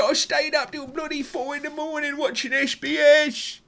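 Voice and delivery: hammed-up bloke complaining about the match; high, loud mock lament over a nil all draw; whiny football fan.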